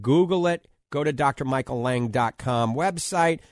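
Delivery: ordinary talk, nothing marked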